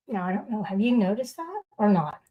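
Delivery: ordinary talk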